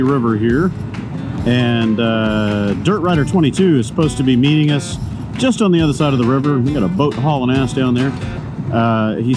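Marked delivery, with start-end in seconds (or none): none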